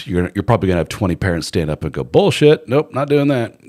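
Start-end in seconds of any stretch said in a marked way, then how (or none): 2.0-3.6 loud, high outburst of refusal; acting out an outraged parent standing up to object; indignant objection. Parents rejecting a proposed school curriculum, indignant.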